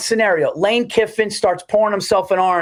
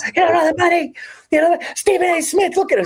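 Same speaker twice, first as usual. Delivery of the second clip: a radio host mocking the coach, higher; imitating Mad Dog; 12 semitones higher than usual. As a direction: rant like Mad Dog in full flight. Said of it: "Mad Dog's going".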